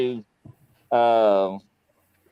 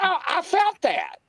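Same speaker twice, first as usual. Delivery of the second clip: high, worked-up retort; indignant exclamation; 12 semitones higher than usual. Retold or imitated. Guest retells answering viewers after being hit, worked up. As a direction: protest it with pitch way up.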